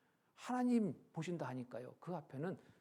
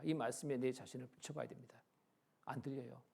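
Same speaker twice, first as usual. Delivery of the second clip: ordinary delivery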